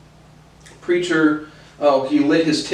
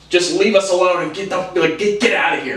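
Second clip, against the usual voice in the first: mildly raised, exasperated imitation; higher-pitched mock irritation; retold angry outburst; 5 semitones higher, 3 dB louder than usual. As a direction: act out an annoyed bystander. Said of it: "in a very, like, angry, mad".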